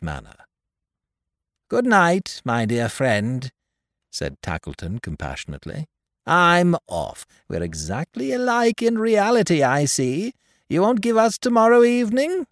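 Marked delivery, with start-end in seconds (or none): 1.7-12.5 high, loud jeering Tackleton voice; Tackleton, sly and cold. Acted. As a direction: play the sneering toymaker, pitched up. Tackleton's voice in the story.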